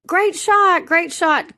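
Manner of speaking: high, bright call-out of encouragement; imitating a cheery shout of praise; retold loud praise. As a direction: call it out high to another cart, mildly loud. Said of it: "saying it loudly".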